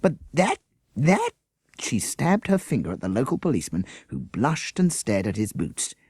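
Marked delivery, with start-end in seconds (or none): 0.0-1.3 high, halting indignant repeat; sputtering indignation. Acted. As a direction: sputter in outrage at him. Teacher sputtering in outrage.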